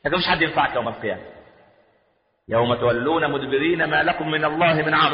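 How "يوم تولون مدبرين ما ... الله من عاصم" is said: even, sustained verse chant; Quranic recitation. Acted. Preacher returning to the verse before explaining, recited. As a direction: recite on a steady line, vowels held.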